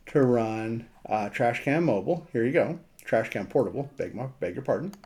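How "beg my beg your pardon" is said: ordinary talk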